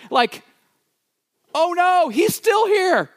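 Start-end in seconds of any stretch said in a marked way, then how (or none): 1.5-3.1 high-pitched, drawn-out cry of dread; mock great fear. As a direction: play soldiers panicking at the sight of a giant. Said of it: "they fled in great fear".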